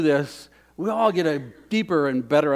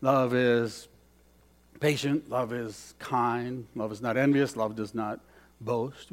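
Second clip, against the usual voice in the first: soft scripture recital; 5 semitones lower, 7 dB quieter than usual. Acted. Reciting 1 Corinthians softly.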